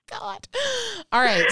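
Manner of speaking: high embarrassed laugh; laughing off embarrassment. Real, not acted. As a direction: laugh it off, then recover. Laughs off awkward joke.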